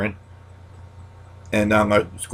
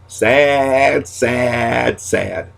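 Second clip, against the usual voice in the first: mock sad catchphrase; 3 semitones higher, much slower than usual. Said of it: "my new saying".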